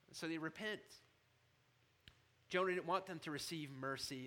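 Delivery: ordinary talk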